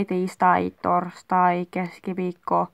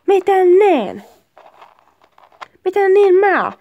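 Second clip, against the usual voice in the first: high, indignant back-and-forth; heated bickering; 6 semitones higher, 3 dB louder, slower than usual. Acted. Couple arguing who must leave, heated and indignant. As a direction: act outraged, throw each retort up high.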